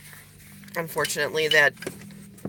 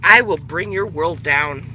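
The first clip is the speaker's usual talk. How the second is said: loud, level and cold; menacing threat; 6 dB louder than usual. Real, not acted. Final threat to liars, loud and menacing.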